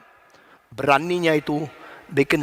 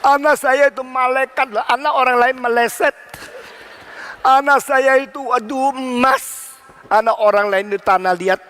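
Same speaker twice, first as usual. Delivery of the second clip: raised-pitch, gushing imitation of a proud parent; mock boasting parent; 5 semitones higher than usual. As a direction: brag about your child, exaggeratedly. (tiruan orang tua yang membanggakan anak)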